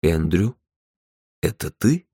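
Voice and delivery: hesitant male caller with careful pauses; slow, careful. A narrator's reading. Man on the phone checking it is Andrew, slow and careful. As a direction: ask tentatively, leaving a gap after the name. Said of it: "Он говорил медленно и осторожно".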